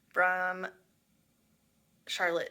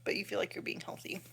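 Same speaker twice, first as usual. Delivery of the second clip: whisper; 8 dB quieter than usual. Real, not acted.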